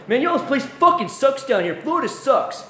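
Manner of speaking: high, mocking whine; mock griping New Yorker. Transplant telling wife Florida sucks, high mock whine. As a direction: sneer it like a rude transplant. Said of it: "They tell my wife that".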